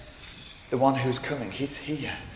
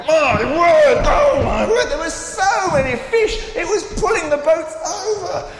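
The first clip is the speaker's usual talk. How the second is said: higher and louder, amazed storyteller; excited; 9 semitones higher, 6 dB louder than usual. A narrator's reading. Narrator telling children about the huge catch, thrilled. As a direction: gasp it out like the nets are bursting.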